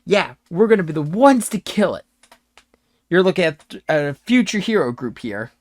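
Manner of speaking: cocky novice adventurer, fired up; excited boast. Acted. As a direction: brag excitedly to a stranger. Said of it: "just excitedly looks at you and goes".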